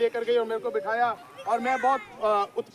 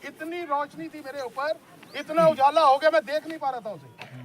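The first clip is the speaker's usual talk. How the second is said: normal talking voice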